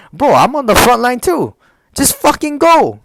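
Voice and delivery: pleading, heated outburst; exasperated retort. Retold or imitated. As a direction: act out being fed up with a holdup. Exasperated retort in retold argument.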